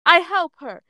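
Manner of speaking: put-on high, stubborn little-kid voice; scowling child. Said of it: "Ash scowls and says".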